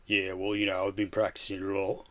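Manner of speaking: put-on a touch of London accent; mock Cockney. Streamer answering as Bizzle, light Cockney. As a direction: play the practised geezer lightly. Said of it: "they have an accent".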